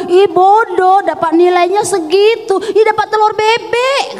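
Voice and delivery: high-pitched schoolyard taunt; mocking classmates. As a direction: jeer like a teasing classmate. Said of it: "kawan-kawan semua pada ngejek".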